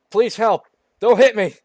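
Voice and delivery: high, loud panicked cry for help; panicked shout. Acted. Girl fleeing a monster begs the driver, panicked shout. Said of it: "shouted Alice in panic".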